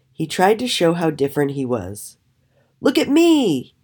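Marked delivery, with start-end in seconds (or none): 2.8-3.8 high, showy call for attention; attention-seeking call. A narrator's reading. Raven trying to show he's different, calling out high.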